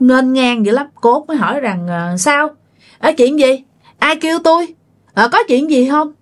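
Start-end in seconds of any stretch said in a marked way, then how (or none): 1.9-6.1 cocky, dismissive questions pitched higher; arrogant swagger. Retold or imitated. Youngest aunt brushes off the spirit: arrogant and dismissive. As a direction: toss the questions off like nobody can touch you. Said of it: "nói chuyện kiểu nghênh ngang kiêu ngạo".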